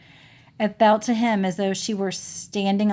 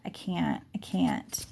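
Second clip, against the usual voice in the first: whispered refusal; 8 dB quieter, slower than usual. Acted. Jessie refuses to go in, whispering. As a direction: barely breathe it out.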